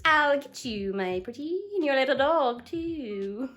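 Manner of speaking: cackly movie-witch menace, drawn out; hammed-up villain quote; Wicked Witch of the West. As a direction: threaten like a storybook witch. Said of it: "the one who says".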